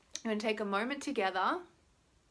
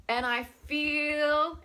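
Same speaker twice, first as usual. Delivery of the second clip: "feel" stretched long, high and loud; drawn-out word; 5 semitones higher, 4 dB louder, much slower than usual. Shown as a demonstration. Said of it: "draw out that word".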